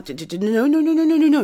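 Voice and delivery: loud, high rush of protest; urgent denial. Acted. Friend hurrying to correct a misunderstanding, urgent. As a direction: stammer into a rising string of nos. Hurried denial of excusing parents.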